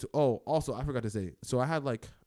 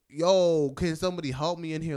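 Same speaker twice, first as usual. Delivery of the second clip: retold yelling for help; 6 semitones higher, 9 dB louder than usual. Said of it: "Like, yelling out of my room".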